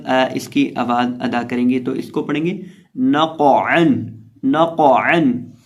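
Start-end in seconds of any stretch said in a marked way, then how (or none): 3.0-5.5 नक़अन slow, thick क़ bounced into mid-throat अ; bounced qaf, throaty ain. Shown as a demonstration. Teacher modelling tajweed, slow नक़अन with bounced क़. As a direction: bounce the heavy क़ in नक़अन, then squeeze अ from the throat. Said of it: "आइन को हम हलक के दरमियान".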